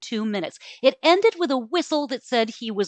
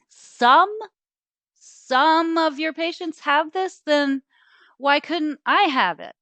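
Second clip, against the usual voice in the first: high, pressing, rising questions; eager disbelief; 9 semitones higher, 3 dB louder than usual. Acted. Ava to the doctor, catching his slip, eager and high. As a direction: pounce on his slip, voice jumping up.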